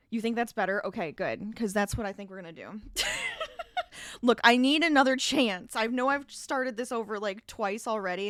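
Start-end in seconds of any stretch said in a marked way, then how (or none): 2.9-4.2 bright burst of laughter; high amused laugh. Real, not acted. Laughing at own joke.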